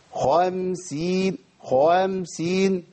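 خمسين very slow with an alif pushed into the kha; mock the over-thickened kha in خمسين; overdone heavy kha. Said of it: "يقول لك إيه". (خمسين بخاء مفخمة مبالغ فيها وألف زائدة)

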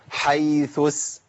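slow chanted "حيث", as "haythus" with the second tha hissed as s; wrong tha sounds. Shown as a demonstration. Teacher showing another error, drawn-out "حيث" ending in s. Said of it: "ولا أقول".

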